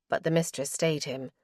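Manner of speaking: ordinary delivery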